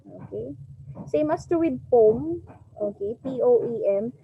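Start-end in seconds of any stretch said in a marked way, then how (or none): none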